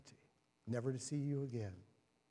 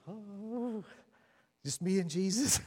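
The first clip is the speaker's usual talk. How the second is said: mocking smug believer; 6 semitones higher, 3 dB louder, slower than usual. Mocking complacent churchgoers.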